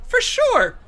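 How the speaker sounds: high, bright burst of praise; eager yes. Acted. Agent enthusiastically answers the junior agent, high and excited. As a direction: blurt it out eagerly.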